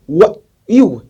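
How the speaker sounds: high, loud incredulous questioning; mock disbelief. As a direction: act out people challenging someone. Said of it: "they start saying".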